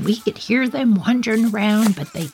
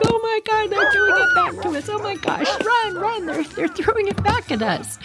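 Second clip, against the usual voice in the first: much higher, shrieking mock panic; mock panicked screaming. Kids fleeing flung horse poop, mock panicked shrieks. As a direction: squeal like you're under attack. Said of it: "we're screaming like they're killing us".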